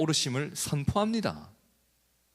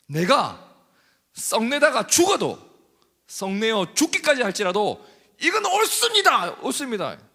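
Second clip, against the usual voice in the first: much higher, louder furious cry; Jonah's angry outburst; 11 semitones higher, 7 dB louder than usual. Acted. Jonah shouting at God in fury, high and loud. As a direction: rage at God, begging to die. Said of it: "이렇게 대답을 해요".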